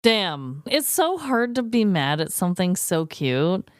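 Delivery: usual speaking voice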